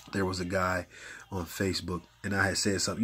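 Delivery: ordinary delivery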